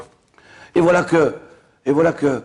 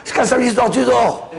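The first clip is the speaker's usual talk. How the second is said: comic outburst; 11 semitones higher, 3 dB louder than usual. Retold or imitated. Punchline repeated loudly for laughs.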